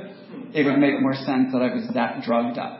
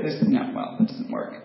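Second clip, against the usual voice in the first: ordinary talk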